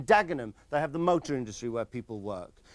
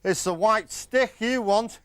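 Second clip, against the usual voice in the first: mock punchline; 9 semitones higher, 6 dB louder, slower than usual. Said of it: "joke doesn't end with the punchline".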